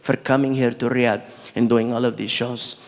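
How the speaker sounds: low, measured, gracious royalty; prince's voice. Retold or imitated. Prince thanking the comic for the shows, low and gracious. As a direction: speak deep and formal, like a gracious prince. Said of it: "He's like".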